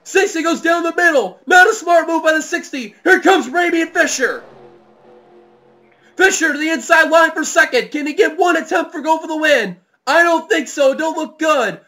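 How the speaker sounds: high, shouted race call; excited play-by-play. Real, not acted. Commentator following the last-lap charge, shouted excited play-by-play. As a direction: shout each move as it happens.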